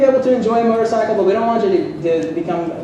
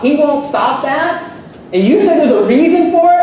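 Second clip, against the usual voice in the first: incredulous; 7 semitones higher, 4 dB louder than usual. Real, not acted. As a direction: stretch it out in disbelief. Incredulous rhetorical question.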